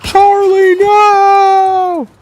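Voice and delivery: high, loud hammed-up wail; mock crying. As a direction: cry out like a bereft friend, overdone. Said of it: "He's, like, crying".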